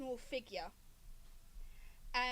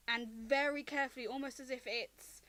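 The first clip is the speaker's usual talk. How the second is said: ordinary delivery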